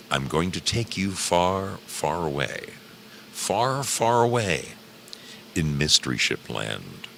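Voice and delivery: drawn-out dreamy far away; dreamy storyteller. Acted. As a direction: lure them off into a dream.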